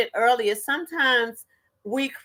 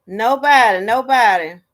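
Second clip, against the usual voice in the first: Nobody said louder, falling, repeated; emphatic repeat; 7 dB louder than usual. Real, not acted. Teasing viewers for an empty chat, stressed Nobody. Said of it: "I ain't seen nobody put that in the chat".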